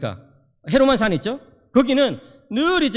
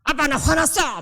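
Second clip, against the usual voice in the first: higher-pitched retold child's outburst; huffy angry child; 6 semitones higher, slower than usual. Preacher voices his son coming home from school, huffing angrily. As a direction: imitate a son storming in indignant. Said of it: "막 씩씩거리는 거예요".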